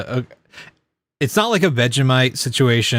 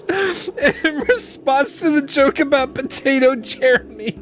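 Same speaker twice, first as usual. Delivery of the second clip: laughing through words; 10 semitones higher than usual. Real, not acted. Laughing at Vanilla's reply.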